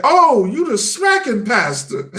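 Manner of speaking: high, drawn-out mock astonishment; stretched-out high-pitched exclamation; amused recognition. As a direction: imitate a delighted stranger spotting someone. Stranger's amused recognition, acted out.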